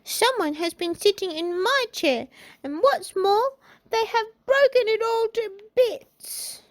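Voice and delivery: put-on very high baby bear; little baby voice. A narrator's reading. Baby Bear finding his chair broken, high baby voice. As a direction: pipe it up, building to the broken chair. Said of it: "said in his little baby voice".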